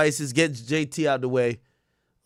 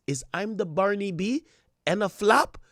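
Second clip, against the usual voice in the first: high-pitched mock rapper-diva voice; 5 semitones higher than usual. Re-reading the post as its author, high diva impression. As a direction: imitate her, pitched up with rising questions. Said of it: "you gotta read this in a [name] voice".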